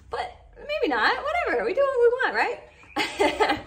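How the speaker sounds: giggly defiance; defiant giggle at keeping it long; laughing. Real, not acted. Playful defiance about long video.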